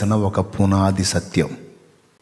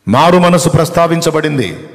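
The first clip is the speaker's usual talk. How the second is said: high, loud, forceful declaration; ringing preacher's emphasis, falling at the end; emphatic proclamation; 5 semitones higher, 7 dB louder than usual. Real, not acted. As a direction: proclaim "మారు మనసు" with a big raised attack. Repeats the point forcefully.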